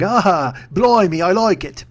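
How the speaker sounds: hammed-up British baker, drawn out; Paul Hollywood. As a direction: do a broad, silly English impression. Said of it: "He probably didn't talk like that".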